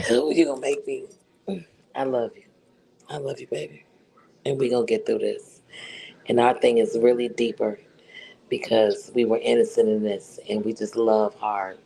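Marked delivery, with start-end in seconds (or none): none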